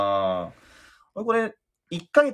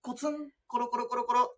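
putt sound effects; faster than usual. Pretend. Acting putt rolling short.

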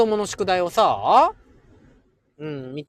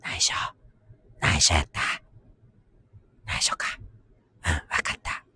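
hushed, voiceless whisper; playful hush-hush whisper, with pauses; secretive whisper; 4 dB quieter, faster than usual. Pretend.

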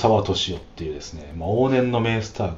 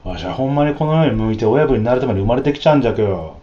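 fast, louder Hiroshima yakuza boast, a touch higher; thick Hiroshima dialect; 4 semitones higher, 6 dB louder, faster than usual. Retold or imitated. 映画の広島ヤクザを真似て語る, loud fast Hiroshima brag. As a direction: rattle it off like a Hiroshima gangster boss. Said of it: "もうバリバリの広島弁で".

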